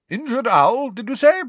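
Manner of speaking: very high, loud interjection; interested professor. A narrator's reading. Professor breaking in about injured owl, high-voiced. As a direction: pipe up from behind, high and loud.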